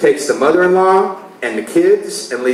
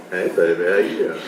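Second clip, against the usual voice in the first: much lower, soft come-on; low seductive come-on; 10 semitones lower, 7 dB quieter than usual. Retold or imitated. Husband in bed sweet-talking his wife, low and soft. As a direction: purr it like a smooth lover.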